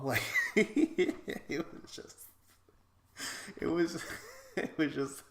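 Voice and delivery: can't get the words out for laughing; giggling helplessly while trying to explain. Real, not acted. Cracking up recalling the quote.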